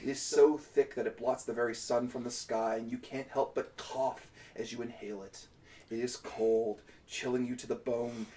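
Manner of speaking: soft, grim scene setting; story narration. Acted.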